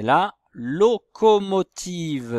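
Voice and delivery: deliberate, projected teacher enunciation; sounding out syllables. Shown as a demonstration. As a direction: stretch the word out for young pupils. Sounding out word for pupils.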